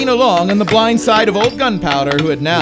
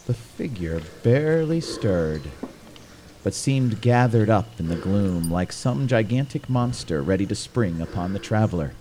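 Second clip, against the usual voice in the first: very low, soft storyteller voice; hushed, ominous narration; 9 semitones lower, 9 dB quieter than usual. A narrator's reading. Narrator describing the figure in the gloom, hushed suspense. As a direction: bring it right down for suspense.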